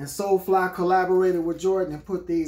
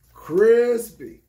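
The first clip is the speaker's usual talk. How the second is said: crispy louder and higher, punched with delight; hyped crispy; 5 semitones higher, 8 dB louder than usual. Real, not acted. Repeating praise of the shoes to viewers, hyped and loud. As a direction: hype the word crispy up.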